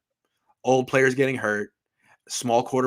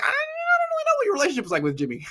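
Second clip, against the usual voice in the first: high, fast, put-on puzzled voice; mock puzzled inner voice; faster than usual. Reporter imitating his own baffled thought, high fast put-on voice. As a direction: play yourself baffled, quick and squeaky. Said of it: "I was like".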